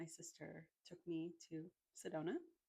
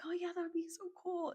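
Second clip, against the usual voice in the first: excited sister; 13 semitones higher, 8 dB louder than usual. Retold or imitated. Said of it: "And she's like".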